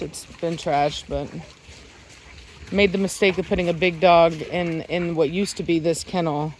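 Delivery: ordinary talk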